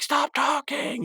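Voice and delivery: high, drawn-out mock yell; exasperated outburst. Rigby to teasing Mordecai, exasperated high mock yell. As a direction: imitate a cartoon character losing patience.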